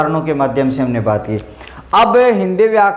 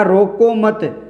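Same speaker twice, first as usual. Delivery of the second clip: रोको मत drawn out slowly as one unit; no break between रोको and मत; joined रोको मत; 3 semitones higher, much slower than usual. Shown as a demonstration. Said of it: "को एक साथ पढ़ा". (रोको मत एक साथ, धीरे)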